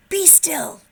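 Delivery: high, loud command; yelled. Acted. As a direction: bark it like a king. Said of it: "until Max yelled".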